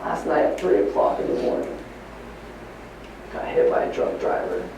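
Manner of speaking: hushed, broken retelling of bad news; barely voiced, as the mother breaking the news through tears; tearful hush. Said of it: "She starts crying".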